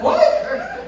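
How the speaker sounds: high-pitched mock-incredulous question; baffled kid. Kid told not to make Doctor Conley cry, imitated high bafflement. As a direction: squeak it up like a bewildered child. Voices a baffled kid's reaction.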